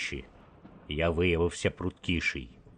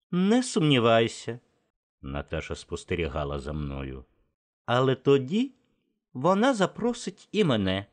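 Natasha's voice; 5 semitones higher than usual. Acted. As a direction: tease him lightly, pitched up. Narrator voices Natasha, high. (високий глузливий жіночий голос)

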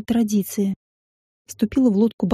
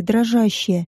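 ordinary delivery

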